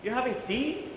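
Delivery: You're having tea with a high rise on tea; simple question. Shown as a demonstration. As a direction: ask it lightly and curiously. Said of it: "It completely changes the meaning".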